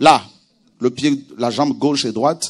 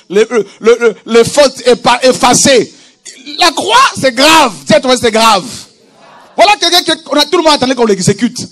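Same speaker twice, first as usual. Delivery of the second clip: loud, raised preaching voice; prédication à pleine force; 7 semitones higher, 8 dB louder than usual. Real, not acted. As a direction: drive it home forcefully to the congregation. (prédication fervente, voix forte)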